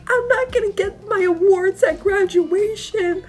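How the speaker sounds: high, wailing classmate on the edge of tears; mock-sob the complaint up high; whiny wail. Classmates crying about lost awards.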